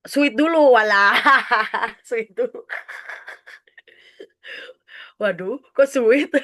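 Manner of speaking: loud amused outburst breaking into laughter; really tickled by the listener's answer; laughing. Real, not acted. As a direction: talk through bursts of giggles.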